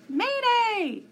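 very high, loud squawking alarm cry; bird shriek. A narrator's reading. The wiffle bird shrieking an alarm as it flies up, high and loud. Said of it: "she shrieked".

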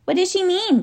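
high-pitched anxious question; alarmed gasp. A narrator's reading. Lindy questions the Professor after the shriek, gasping. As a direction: ask in a high, startled girl's voice. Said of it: "gasped Lindy".

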